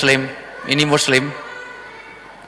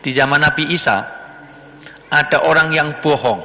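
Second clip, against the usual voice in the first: ordinary talk, nothing marked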